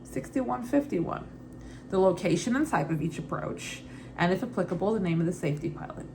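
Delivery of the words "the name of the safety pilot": ordinary delivery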